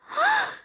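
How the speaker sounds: high, squeaky little gasp; sharp intake of shock. Acted. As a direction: gasp like a startled cub. Baby Bear finds someone in bed.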